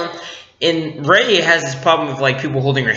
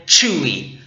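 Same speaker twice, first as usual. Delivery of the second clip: ordinary talk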